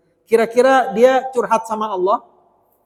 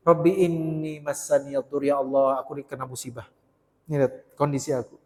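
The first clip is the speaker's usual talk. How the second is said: humble prayer; 6 semitones lower, 8 dB quieter than usual. Acted. Ayub's humble prayer quoted.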